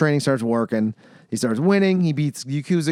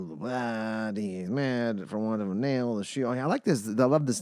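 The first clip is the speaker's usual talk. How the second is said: ordinary delivery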